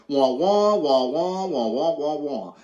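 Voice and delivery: muffled grown-up from a cartoon, all droning wahs; Charlie Brown cartoon wah-wah. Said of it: "like the Charlie Brown cartoon".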